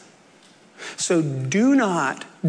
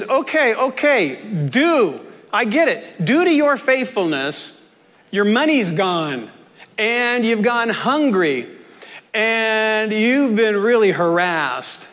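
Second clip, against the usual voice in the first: higher, animated acknowledgement of hardships; emphatic concession; 3 semitones higher than usual. Acted. Jesus conceding to his boys their troubles, emphatic. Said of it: "What he's saying to his boys here is".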